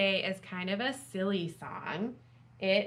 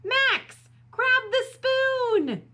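high, loud, drawn-out yell; storybook rescue shout; 8 dB louder, much slower than usual. A narrator's reading. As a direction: holler urgent instructions. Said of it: "yelled Dakota".

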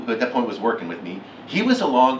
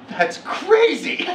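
high, slow, laughing "that's crazy"; laughing wonder at something unreal; amazed disbelief; much slower than usual. Retold or imitated. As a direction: drag it out in stunned disbelief. Retelling own stunned reaction.

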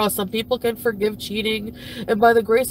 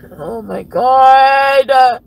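long, slow exasperated yell; drawn-out shout; 10 dB louder, much slower than usual. Real, not acted. Fed up with the whole affair, a slow loud groan of oh my God. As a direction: drag it out in disbelief.

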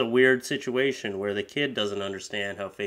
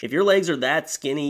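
ordinary talk

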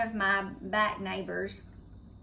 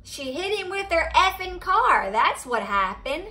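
higher, louder retold accusation; mock accusing outburst; 7 semitones higher, 6 dB louder than usual. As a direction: blurt it out as a tactless accuser. Man's blunt accusation retold.

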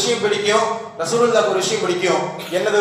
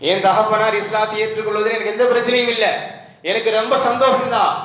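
normal talking voice

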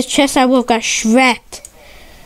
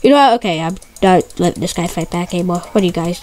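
normal talking voice

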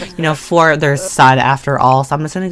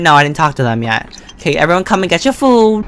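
usual speaking voice

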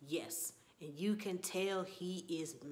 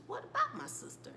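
much higher, breathy shocked question; startled question. Retold or imitated. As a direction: gasp it out in disbelief. Shocked mother asks about sister.

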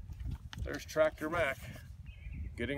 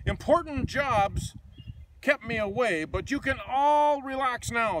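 loud, slow, higher announcing voice; 9 semitones higher, 6 dB louder than usual. Acted. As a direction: boom it out like a big newcomer arriving. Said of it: "said a loud voice".

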